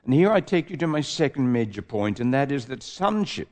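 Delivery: ordinary talk, nothing marked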